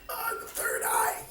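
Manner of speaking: high, hushed whisper of wonder; awed whisper. Retold or imitated. Fan recalling seeing the third eye, awed whisper. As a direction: breathe it out in reverent awe.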